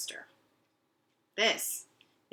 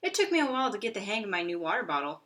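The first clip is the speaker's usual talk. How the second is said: usual speaking voice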